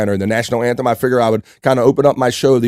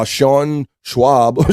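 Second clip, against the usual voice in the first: "Sean Schwab" stretched out very slowly, rising at the end; puzzled slow reading of "Sean Schwab"; slow drawn-out name; 2 semitones higher than usual. Pretend. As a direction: savor the wrong name slowly. Reading odd new surname.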